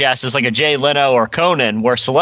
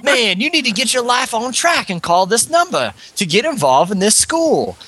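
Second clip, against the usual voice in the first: preachy TV commercial voice; 9 semitones higher than usual. Retold or imitated. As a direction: lecture like a condescending ad.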